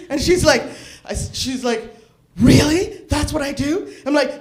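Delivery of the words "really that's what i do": loud, rising mock disbelief; amazed daughter. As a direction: act shocked, much louder.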